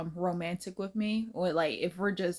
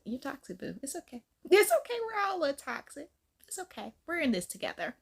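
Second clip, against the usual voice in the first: teasing comfort; 7 semitones higher than usual. Acted. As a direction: coo it like comforting a friend. Comforting the toxic viewer.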